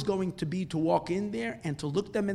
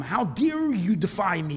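slow, louder acted rebuke; stretched-out angry challenge; indignant outrage; 3 semitones higher, 6 dB louder, slower than usual. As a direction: demand it with affronted authority. Leader rages at defiant man.

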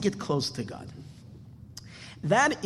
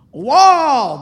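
loud, higher, drawn-out wow; amazed wow; 8 semitones higher, 15 dB louder than usual. Acted. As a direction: act out the king's astonishment at a talking bird. King astonished by talking bird.

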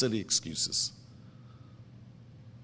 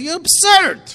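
exasperated outcry; 11 dB louder than usual. Acted. Mocking the listener's excuse.

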